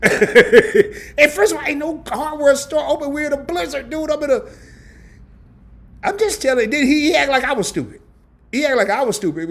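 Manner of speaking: high, loud, laughing disbelief; high laughing rant. Real, not acted. Vlogger venting to viewers about support call, high laughing rant. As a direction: vent it with a laugh, pitch way up.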